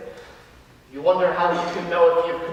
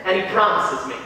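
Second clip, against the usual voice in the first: normal talking voice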